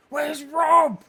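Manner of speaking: high, loud retold scream; shouting for Rob into the office. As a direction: bellow the name across an empty office. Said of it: "Like that, screamed it".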